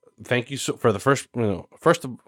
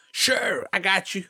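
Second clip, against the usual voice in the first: voicing Billy; 9 semitones higher, 4 dB quieter than usual. Billy voiced agreeing on phone.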